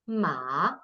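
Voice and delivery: "ma" dipping down then up as Mandarin third tone. Shown as a demonstration. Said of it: "is third tone".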